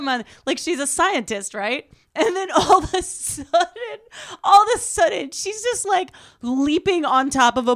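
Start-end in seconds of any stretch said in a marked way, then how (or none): 2.1-6.1 laughing. Real, not acted. Laughing while retelling.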